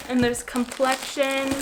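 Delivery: ordinary talk, nothing marked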